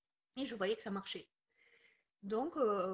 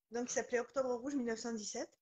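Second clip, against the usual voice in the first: ordinary delivery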